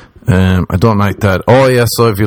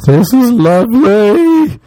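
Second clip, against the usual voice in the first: high, slow hammed-up shriek; mock screaming at dinner; 13 semitones higher, much slower than usual. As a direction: squeal it out like overdone praise. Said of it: "eating dinner and screaming".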